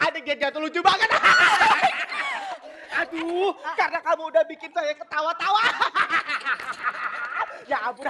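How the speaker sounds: talking through laughter; giggling between words; laughing. Real, not acted. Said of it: "Kakak saya bisa ketawa sekarang".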